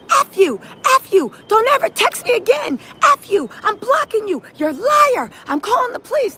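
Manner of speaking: high, indignant mock tirade; acted angry outburst. Retelling him flipping on her, mock angry outburst. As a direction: imitate someone lashing out, pitched well up.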